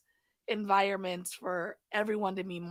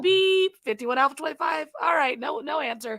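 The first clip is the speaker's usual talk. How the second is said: loud, high beeped radio call; police radio; 5 semitones higher, 6 dB louder than usual. Retold or imitated. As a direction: hail the unit over the radio. Dispatcher beeps and calls again.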